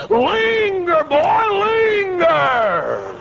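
high, slow drawn-out holler; hollered command. Retold or imitated. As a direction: stretch each "linger" into a high cry. Ordering men to linger with plate.

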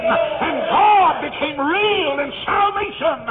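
slow, high, loud proclamation; preaching shout. Real, not acted. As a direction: stretch it out and cry it to the rafters. Preaching climax.

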